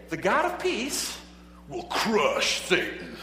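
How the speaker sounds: booming slow announcer voice; monster truck ad. Shown as a demonstration. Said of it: "You gotta say it with that, you know, monster truck voice".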